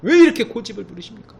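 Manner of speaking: reproachful scolding, high then loud; exasperated critics. Retold or imitated. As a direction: people around scold the stubborn pastor. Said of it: "주변은요".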